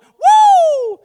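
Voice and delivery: loud, very high drawn-out whoop; mock cheer, shrieked high. Heart jumping at serving others, excited whoop. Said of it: "doesn't jump at that and go".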